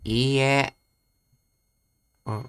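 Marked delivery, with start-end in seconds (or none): none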